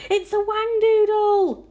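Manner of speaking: very high, loud, long-held cry; alarmed yell. A narrator's reading. Fire chief outdoing the police chief's guess, high yell. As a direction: yell it high, stretching the monster's name out. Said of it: "yelled the head of the fire department".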